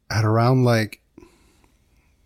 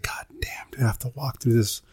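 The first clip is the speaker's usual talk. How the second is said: hushed, breathy dread under the breath; scared whisper; 7 dB quieter than usual. Retold or imitated. Alone at night facing the dark walk, scared whisper. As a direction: whisper it like you're afraid of the dark.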